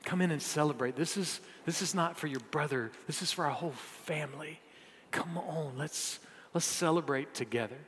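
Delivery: soft, coaxing father; hushed, tender appeal from a father; gentle pleading. Retold or imitated. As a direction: plead quietly with a hurt son. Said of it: "he pleaded with him".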